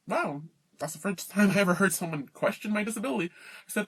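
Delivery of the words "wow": ordinary talk, nothing marked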